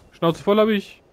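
really mean it the second time. Real, not acted.

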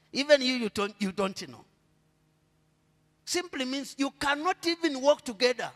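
normal talking voice

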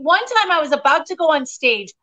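ordinary talk

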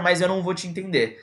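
ordinary talk

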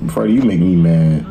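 ordinary talk

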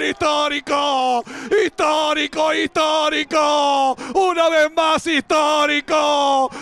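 shouting, high and slow, euphoric commentary; football goal call. Real, not acted. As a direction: celebrate a historic win on air. Winning penalty called live.

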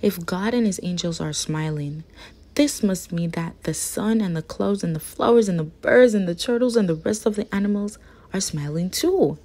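ordinary delivery, nothing marked

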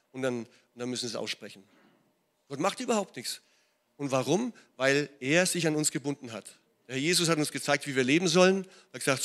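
ordinary talk

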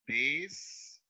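DES held long as its own syllable; dictated syllable "des", stretched; isolated syllable. Shown as a demonstration.

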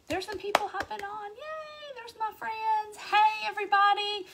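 excited. Real, not acted. Greeting viewers joining live.

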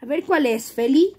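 ordinary talk

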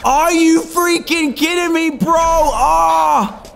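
high, stretched cry of disbelief; outraged shout. Real, not acted. Player falls from the tree top, outraged high shout. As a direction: yell it out in exasperated outrage.

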